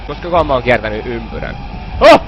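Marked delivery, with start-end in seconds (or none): none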